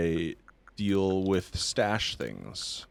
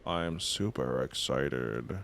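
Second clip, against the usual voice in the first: sarcastic deadpan; 6 semitones lower, 4 dB quieter than usual. Real, not acted. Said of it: "See the excitement in my voice?".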